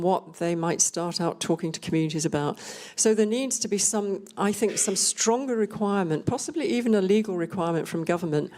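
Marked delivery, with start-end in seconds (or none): none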